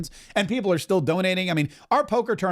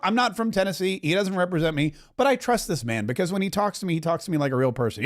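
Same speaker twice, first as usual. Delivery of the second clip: usual speaking voice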